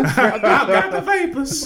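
slow high fainting belle, laughing; southern belle. Pretend. Southern belle joke continued.

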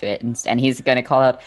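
ordinary talk, nothing marked

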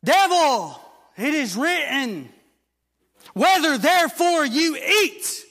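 loud, high, ringing proclamation; preaching at full force. Acted. Preacher to congregation, loud ringing proclamation.

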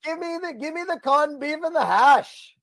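high-pitched mock Irish pub voice; bad Irish accent. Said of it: "I'm bad at accents".

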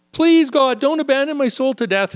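half-acted desperate psalm plea. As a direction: beg God as if drowning.

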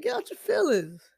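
a no-nonsense God setting them straight; God rebuking. Retold or imitated. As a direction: tell them straight to get out of their feelings. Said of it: "He's like".

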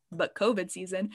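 ordinary talk, nothing marked

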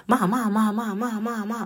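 high, whiny Stewie-style chant of ma; imitating her kids. Mother imitating kids pestering her awake, high whiny chant. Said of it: "they say it just like that".

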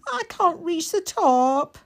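high, slow and wavering; trembling little boy. Acted. Mikey scared, reaching for the prints, trembling. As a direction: let the small voice quiver. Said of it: "His voice trembled".

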